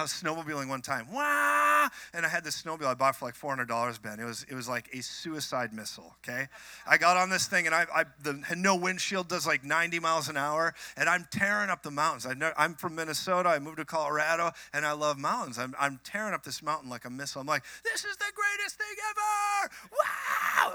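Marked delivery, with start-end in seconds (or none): 1.1-1.9 long, high, loud "waah"; excited engine-like wail. Retold or imitated. Mimicking the racing snowmobile. 17.8-20.7 very high, strained squeal of joy; thrilled yelling. Retold or imitated. Reliving the thrill of riding.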